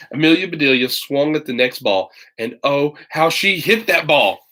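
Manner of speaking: plain reading voice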